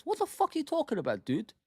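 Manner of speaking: fed-up fan venting at the press; exasperated. Real, not acted. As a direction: throw the question back in disbelief. Outrage at resting players question.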